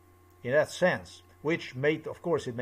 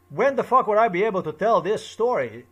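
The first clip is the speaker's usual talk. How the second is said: loud, higher, exasperated rant; angry outburst; 6 semitones higher, 8 dB louder than usual. Real, not acted. Venting to the camera about never getting his turn, loud and angry. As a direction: let the years of frustration burst out.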